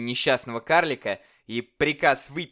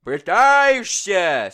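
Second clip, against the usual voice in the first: high, loud, slow furious yell; fierce shout; 7 semitones higher, 8 dB louder, much slower than usual. Acted. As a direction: roar it back at him in rage. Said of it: "свирепо закричал тиран".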